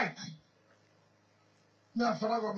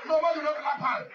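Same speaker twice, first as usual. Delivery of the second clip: raised, emphatic call to the crowd; loud call; 5 semitones higher, 5 dB louder than usual. Real, not acted. Speaker to the rally crowd, a loud call. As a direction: hammer it home over the crowd.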